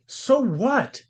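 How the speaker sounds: incredulous, pitched high, tossing the excuse aside; scoffing so what. Real, not acted. Scoffing at the preacher's excuse for shielding abusers, incredulous. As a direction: scoff at it like it's absurd.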